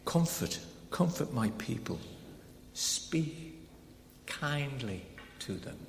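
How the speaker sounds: soft, slow and tender; hushed tender comfort. Shown as a demonstration. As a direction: speak it gently like comforting a grieving friend. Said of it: "do you see the way I'm saying it".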